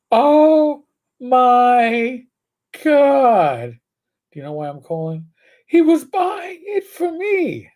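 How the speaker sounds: high, loud gasping oh my God, then pleading; shocked girlfriend. Retold or imitated. Officer mimics the girlfriend hearing about the arrest, high and alarmed. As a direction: imitate the girlfriend's high-pitched panic. Girlfriend reacts to police call.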